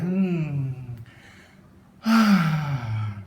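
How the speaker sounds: audible sigh shown as an example; sigh demo. Shown as a demonstration. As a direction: let out a demonstration sigh. Demonstrating a sigh sound.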